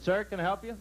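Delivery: bright, lifted salesman greeting; eager salesman. Retold or imitated. Comic voicing the salesman greeting a customer, eager. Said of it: "the man came in and said".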